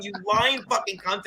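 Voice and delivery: ordinary delivery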